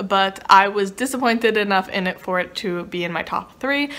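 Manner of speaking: ordinary talk, nothing marked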